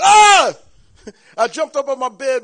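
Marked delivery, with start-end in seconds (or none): 0.0-0.5 loud high scream of fright; retold scream. Husband screaming at a snake under his pants. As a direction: shriek like a snake just appeared. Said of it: "I screamed".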